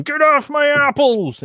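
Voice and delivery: high-pitched shopkeeper yell; angry yelling. Acted. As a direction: holler it like an outraged market vendor. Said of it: "shop owners are yelling at him".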